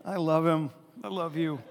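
usual speaking voice

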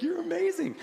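high-pitched gushing; adoring parent. Retold or imitated. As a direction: coo with delight at the child. Parents praising toddler.

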